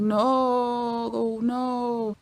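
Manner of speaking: long, slow mock-dismayed groan; drawn-out dismay. Reacting to mom's Control V mistake, drawn-out dismay. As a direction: stretch every word out in dread.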